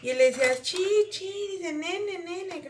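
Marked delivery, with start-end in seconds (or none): 0.6-1.3 imitación aguda de un niño chiquito; higher, toddler-like baby talk; niño balbuceando. Retold or imitated. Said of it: "entre sus balbuceos". 1.7-2.7 higher, softer imitation of a toddler; little kid insisting in baby talk; niño balbuceando. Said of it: "entre sus balbuceos".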